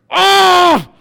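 loud, high "oh" of pain; one long, high-pitched yell; pained scream. Retold or imitated. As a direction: reenact screaming at a smashed toe. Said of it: "I screamed".

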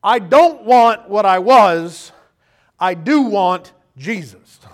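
loud, slow declaration; resolved believer. Retold or imitated. The changed believer declaring his want, loud and slow. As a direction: declare it, each word weighed. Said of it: "turns around and goes".

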